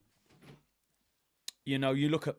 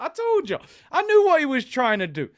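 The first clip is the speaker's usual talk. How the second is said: excited gloating; 7 semitones higher, 6 dB louder than usual. Real, not acted. Gloating reply to rival fan.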